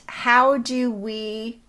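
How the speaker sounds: ordinary delivery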